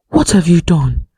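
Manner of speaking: ordinary delivery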